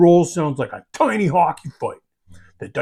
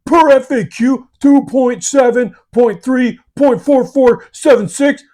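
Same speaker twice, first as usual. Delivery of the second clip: loud, high rattling off rule numbers; rattled-off know-it-all rules quote; know-it-all rule citing; 6 semitones higher, 6 dB louder than usual. Retold or imitated. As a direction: fire off the FAQ citation like a smug rules lawyer. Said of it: "If you come in hot with".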